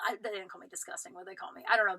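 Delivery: ordinary talk, nothing marked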